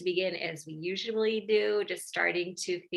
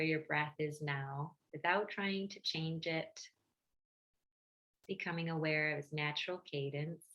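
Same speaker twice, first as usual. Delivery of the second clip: guided meditation; 2 semitones lower, 6 dB quieter than usual. Real, not acted. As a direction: gently guide listeners inward, leaving space between phrases.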